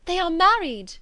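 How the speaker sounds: high and loud, married ringing out; joyful cry. A narrator's reading. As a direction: Jane cries out the good news. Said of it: "cried Jane".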